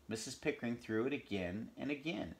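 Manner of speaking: ordinary delivery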